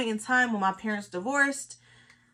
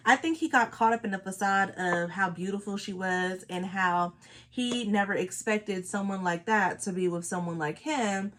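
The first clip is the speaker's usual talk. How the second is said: ordinary talk, nothing marked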